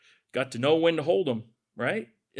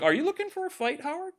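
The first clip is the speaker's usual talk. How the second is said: teasing; 10 semitones higher, 4 dB quieter than usual. Real, not acted. Teasing Howard about rival team.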